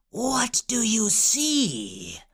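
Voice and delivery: slow, eerie whispery witch; Chaos Witch. A narrator's reading. Witch questions Oxwald about the vision, eerie whisper. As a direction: draw the words out like a haunting. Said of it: "her voice like a thousand whispers".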